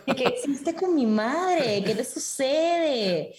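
very slow, drawn-out mock bewilderment; mock disbelief. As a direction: stretch every word in playful shock. Acts out shock at mom's accent. (incredulidad fingida, muy alargada)

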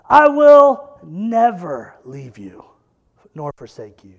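proclaim, then drop to near nothing; climactic proclamation. Acted. Climactic scripture quote.

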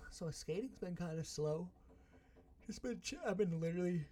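talking through a yawn; words stretched by a yawn. Real, not acted. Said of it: "I can't stop yawning".

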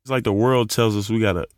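ordinary delivery

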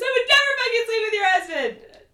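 high, loud hammed-up denial; retold shriek of outrage; outraged mock yell. Castmate denying she slept with husband, mock outraged yell. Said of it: "and she goes".

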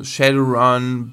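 ordinary delivery

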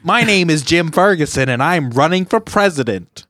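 put-on comic candidate voice; John Candy character. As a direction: announce yourself as a comedy character. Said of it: "talk like a John Candy character".

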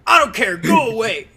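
officer's order. Retold or imitated. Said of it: "He's like".

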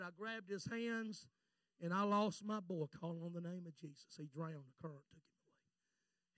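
low, hushed retelling of a drowning; subdued grief. Retold or imitated. Pastor recounting losing his boy, subdued grief. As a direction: sink softly into the painful memory.

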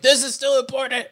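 high-pitched mock solemn insistence; mock earnest Irish-American. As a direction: proclaim it in a squeaky earnest voice. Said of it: "They're like".

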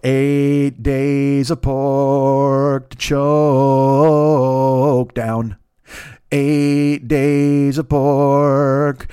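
very slow, stretched-out words; mock-mournful slow drawl; drawn-out dirge. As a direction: drag each word like a lament. Drags out the pork complaint.

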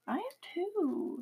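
whispered, slow and tired. Real, not acted. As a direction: breathe it out, worn out. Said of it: "I'm tired".

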